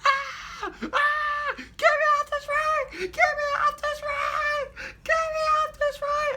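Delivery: high shrieking falsetto, begging to get off; mock screaming panic. Friend on the stuck ride screaming to get off, high panicked shriek. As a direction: shriek like a terrified rider. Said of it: "all I hear is".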